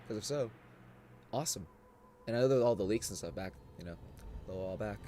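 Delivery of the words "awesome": usual speaking voice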